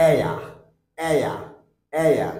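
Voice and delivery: এয়া said with e gliding into a, falling; diphthong demo. Shown as a demonstration. Teacher drilling এয়া for students. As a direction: slide এয়া from e to a. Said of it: "The second one is".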